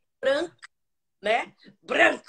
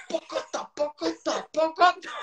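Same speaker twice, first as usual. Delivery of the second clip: clip-clop hoof sounds; 3 semitones higher than usual. Retold or imitated. Horse approaching from the street corner, growing mouth clip-clop. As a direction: click out the horse coming round the corner.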